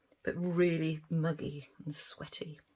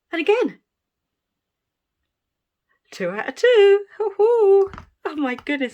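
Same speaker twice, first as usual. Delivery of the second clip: much higher, much louder joyful cheering; thrilled whoops; 13 semitones higher, 14 dB louder than usual. Real, not acted. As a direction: cheer each success out loud. Thrilled by successful unmoulds.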